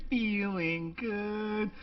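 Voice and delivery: high, slow and drawn out; blissful. Pretend. Setting up the story's happy calm, drawn out high. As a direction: savour it like life is perfect. Mock blissful recap of good day.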